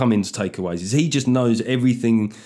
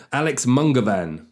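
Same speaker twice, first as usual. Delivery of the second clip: ordinary delivery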